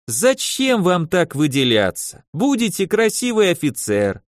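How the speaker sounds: wheedling tailor with a Yiddish lilt; yiddish accent russian. Acted. Tailor persuading. (лёгкий идишский акцент портного)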